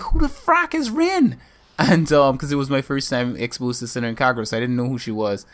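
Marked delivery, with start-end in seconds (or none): 0.0-1.4 high, drawn-out mock disbelief; baffled outburst. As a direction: re-enact total bafflement, pitch shooting up. Retells own baffled outburst.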